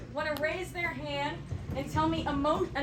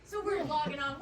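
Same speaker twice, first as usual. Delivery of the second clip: ordinary talk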